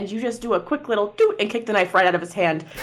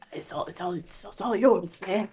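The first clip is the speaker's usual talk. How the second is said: soft, halting surrender; backing-down little elf; 7 dB quieter than usual. Acted. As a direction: stammer it quietly while backing away. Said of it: "The little elf just goes".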